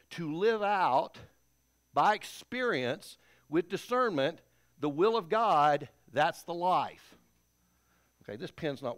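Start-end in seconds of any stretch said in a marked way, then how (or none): 0.1-7.2 drawn-out, louder preaching; slow, deliberate pulpit stress; slow preaching emphasis. Acted. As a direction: lean on each phrase like a preacher.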